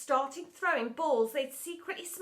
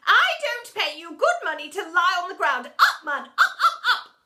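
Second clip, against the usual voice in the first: high, loud, climbing rebuke; scolding shout; 6 semitones higher, 12 dB louder than usual. A narrator's reading. Headmistress orders teacher off the ground, scolding shout. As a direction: shout it, shrieking the last orders.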